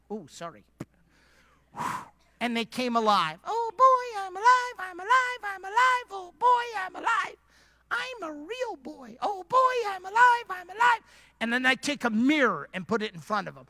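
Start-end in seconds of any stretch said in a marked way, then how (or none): 3.5-11.0 very high, squeaky joyful cartoon voice; excited clay figure. Pastor playing a clay figure just brought to life, gleeful and squeaky. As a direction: squeal with childlike delight at being alive.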